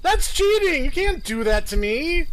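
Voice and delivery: high, loud outraged after a fright; scared horror game streamer. Real, not acted. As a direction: yell at the game, shaken. Jump scared by game enemy.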